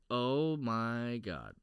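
slow, low, drawn-out disbelief; mock horrified awe; retold stunned reaction. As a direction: stretch it out, low and soft, as if appalled. Retells the guy's reaction to reading.

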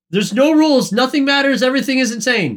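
high-pitched exasperated rant, building. Real, not acted. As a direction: throw up your hands, voice climbing high. Exasperated rant about anime rules.

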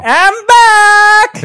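high, loud call on return; loud shout. Real, not acted. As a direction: shout it out coming back in. Calls out returning to room.

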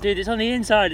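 exasperated shout at the car cleaner; exasperated call. Retold or imitated. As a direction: tell the cleaner off, half shouting. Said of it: "and I'm like".

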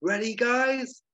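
high, loud, drawn-out call; Aykroyd bullhorn shout. Acted. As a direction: holler it across a huge film set. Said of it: "shouting from the platform through his bullhorn".

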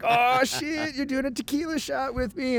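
high-pitched mock party excitement; excited reaction. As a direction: egg them on in a high, excited voice. Acted excited reply to birthday news.

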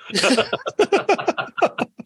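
high burst of real laughter; big laugh. Real, not acted. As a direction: laugh hard at the punchline. Laughs at punchline.

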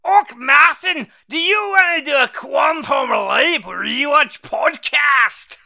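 loud, high, drawn-out mock Scottish accent; Jimmy, Scottish accent. Co-host mimicking his partner's phone call about a podcast, hammed-up Scottish shout. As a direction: bellow it slowly in an exaggerated Scottish accent. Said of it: "don't understand Martin's accent".

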